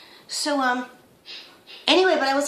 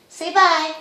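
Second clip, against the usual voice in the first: higher, louder coaxing to the bird; higher, louder sing-song to a parrot; coaxing pet talk; 5 semitones higher, 7 dB louder than usual. Real, not acted. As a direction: lift your voice to coax the parrot.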